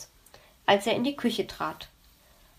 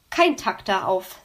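ordinary delivery

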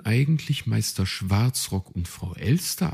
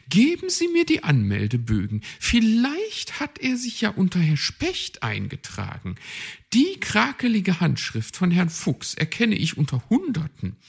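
much higher, brisk and commanding; bossy high magpie; 11 semitones higher, 5 dB louder than usual. A narrator's reading. Magpie demands the forms, bossy. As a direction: demand it, no arguing.

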